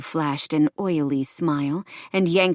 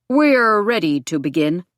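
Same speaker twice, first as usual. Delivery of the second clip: lightly voiced older man, louder; older man calling; 2 semitones higher, 6 dB louder than usual. A narrator's reading. Older male voice calls at the office door, calling out. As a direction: call out as a slightly older man. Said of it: "an older male voice called".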